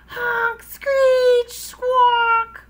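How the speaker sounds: very high, loud honking noises; saxophone squawks. Acted. Froggy blows his saxophone in his room, screeching honks. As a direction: screech each word like a badly blown horn.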